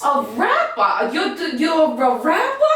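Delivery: can't believe he raps; mother laughing in disbelief at her daughter; incredulous laugh. Acted.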